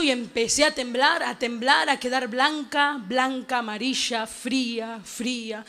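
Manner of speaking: low, soft, slowing account; fading, hushed retelling of a fright; hushed dread. Retold or imitated. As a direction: let each word sink and fade away. Recounts growing cold and faint.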